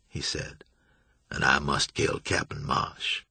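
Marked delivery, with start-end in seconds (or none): 1.3-3.2 low, slow, coolly amused; sophisticated menace, lower; chilly amused threat. A narrator's reading. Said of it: "the chill within the words".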